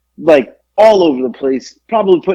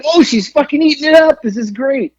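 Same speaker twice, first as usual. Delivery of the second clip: delighted; 10 semitones higher than usual. Retold or imitated. His amused retold reaction.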